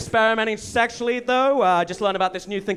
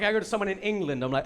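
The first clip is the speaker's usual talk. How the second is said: usual speaking voice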